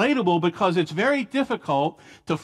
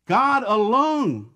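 GOD ALONE drawn out slowly and pitched higher; emphatic stress; 4 semitones higher, much slower than usual. Shown as a demonstration. Preacher showing what David emphasizes, emphatic "God alone". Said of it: "it's there for emphasis".

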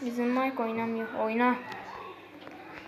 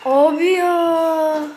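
slow, much louder, higher drawn-out moan; exasperated groan; 4 semitones higher, 10 dB louder, much slower than usual. Real, not acted. Gamer fed up with his teammate, drawn-out exasperated groan. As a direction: let the frustration pour out.

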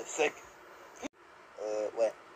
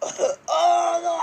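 laughing cry of dismay; 11 semitones higher, 6 dB louder than usual. Real, not acted. Gets eaten by the lion.

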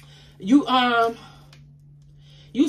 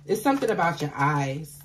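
ordinary talk, nothing marked

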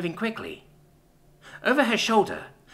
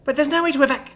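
high, loud, upset mother; suddenly distraught; 11 semitones higher, 5 dB louder, faster than usual. A narrator's reading. Mum learning they can't evacuate, distraught. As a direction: burst out in distress, pitched way up. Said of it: "she says, suddenly distraught".

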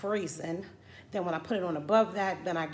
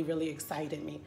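ordinary talk

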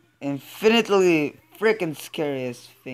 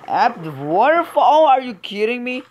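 higher, louder disbelieving question; a louder high incredulous cry; incredulous outburst; 8 semitones higher, 6 dB louder than usual. Real, not acted. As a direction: shout it in disbelief. Disbelief at opponent's hiding place.

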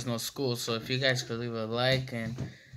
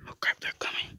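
urgent hushed whisper; whispering while hiding; 4 dB quieter, much faster than usual. Real, not acted. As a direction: whisper in a panic, staying hidden. Hiding, seekers approach.

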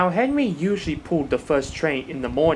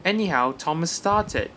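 plain reading voice

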